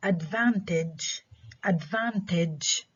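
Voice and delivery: advantage slowly, stressed on VAN; stress second-to-last syllable. Shown as a demonstration. Teacher modelling for students, advantage with VAN stressed. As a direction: draw out advantage with a falling end. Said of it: "ستريس على المقطع ما قبل الأخير".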